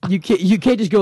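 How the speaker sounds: usual speaking voice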